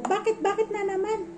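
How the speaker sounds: a sharp, fed-up "what now?"; exasperated why. Retold or imitated. Narrator reacting to renewed trouble. (nagtatakang inis na tanong)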